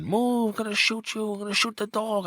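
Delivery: high-pitched mock yell of a cop; retold cop screaming. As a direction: yell it like a cop storming in, half-joking.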